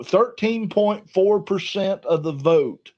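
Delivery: usual speaking voice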